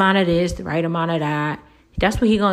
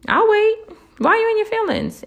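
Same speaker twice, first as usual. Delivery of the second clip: very high, sing-song taunting question; high teasing jab; mock impatient. Real, not acted. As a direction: taunt them in a high squeak. Challenging the listener, mock waiting.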